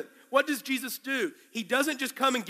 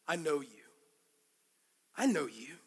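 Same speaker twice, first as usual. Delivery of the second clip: tender quiet; 8 semitones lower, 8 dB quieter than usual. Acted. Jesus speaks to Peter.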